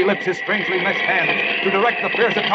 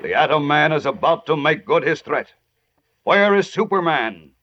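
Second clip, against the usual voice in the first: dramatic narration. A narrator's reading.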